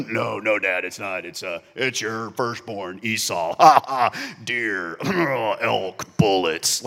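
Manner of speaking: hammed-up rugged outdoorsman, slow and loud; mock manly Esau voice. Jacob fakes Esau's voice for his father, hammed-up and gruff. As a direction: play the man's man of the open country, badly faked. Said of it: "he changed his voice a little bit".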